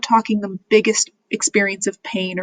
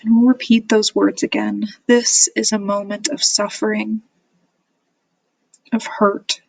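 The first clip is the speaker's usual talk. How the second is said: measured meditation guide with long pauses between phrases; guided meditation. Real, not acted. Therapist guiding listeners through self-compassion, calm meditation voice. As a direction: lead them gently, leaving silence after each phrase.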